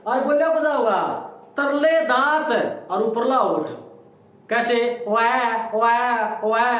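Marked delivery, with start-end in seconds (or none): 5.1-6.7 put-on child crying sound. Shown as a demonstration. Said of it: "नू लागत लो एक बच्चा रोए है".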